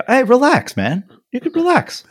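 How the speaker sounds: high, mocking relax-man voice; sneering at him to calm down; condescending guy. Young guy telling him to relax, high and sneering. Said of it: "And he's like".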